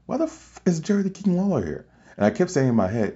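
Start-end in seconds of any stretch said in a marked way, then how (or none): 0.0-1.8 half-laughing, incredulous double take; stunned disbelief. Retold or imitated. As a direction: pitch jumps way up in shocked disbelief. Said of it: "and I'm like".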